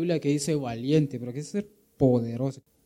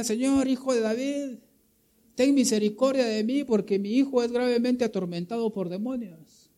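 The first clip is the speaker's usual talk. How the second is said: pleading woman; 8 semitones higher than usual. Acted. Preacher voices pleading Canaanite woman.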